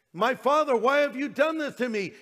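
high, loud reproachful plea; accusatory cry. Retold or imitated. Jesus on the cross crying out to God, accusatory. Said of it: "That could sound accusatory".

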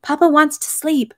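high, slow, anxious hushing; frightened whisper. A narrator's reading. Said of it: "Countess Maria's frightened whisper".

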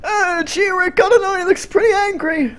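hammed-up high timid voice; squeaky cartoon voice. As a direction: squeak it out worried. Squeaky put-on voice.

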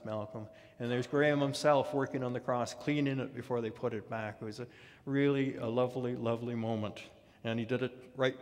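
usual speaking voice

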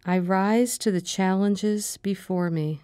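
calm, measured meditation voice, short phrases with long pauses; guided meditation. Acted. Meditation leader giving affirmations to repeat after her, calm and measured. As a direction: guide them gently through breathing and affirmations, unhurried.

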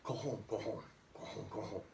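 soft, small fake coughs; quiet mimed "koho" coughing; light cough imitation. Shown as a demonstration. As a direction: cough lightly through the syllables. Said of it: "A light cough".

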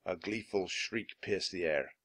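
ordinary delivery

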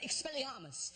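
a hint of a child casting a spell; kid casting a spell. Little kid answering the drunk with a spell. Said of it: "this little kid just went".

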